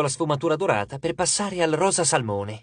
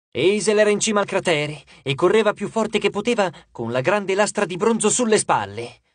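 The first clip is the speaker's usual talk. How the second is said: usual speaking voice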